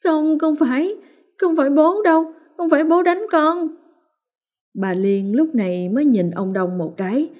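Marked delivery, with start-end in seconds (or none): 0.0-3.7 put-on high, small tearful child's voice; sobbing child. A narrator's reading. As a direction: sniffle the denial through sobs. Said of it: "trong tiếng nấc nấc thút thít". (con Hoa thút thít nói với mẹ)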